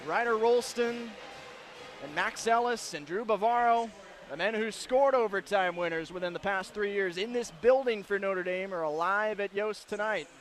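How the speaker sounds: ordinary talk, nothing marked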